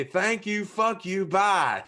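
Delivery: high, brisk sign-off in imitation; Jim Cornette. Podcaster imagining the rival's sign-off, high imitated dismissal. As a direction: raise the voice for a mock dismissal. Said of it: "he might say this and say".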